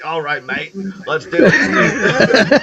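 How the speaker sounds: hammed-up Australian accent; mock Australian accent. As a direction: mimic your pal with a botched Australian accent. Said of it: "Well, I'm not Australian".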